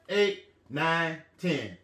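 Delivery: ordinary delivery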